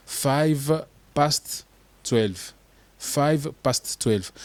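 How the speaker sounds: five past twelve said slowly and carefully; slow model phrase. Shown as a demonstration. Teacher modelling the time, slow. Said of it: "sonner comme un anglophone".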